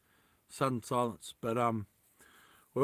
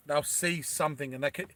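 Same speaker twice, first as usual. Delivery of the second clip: normal talking voice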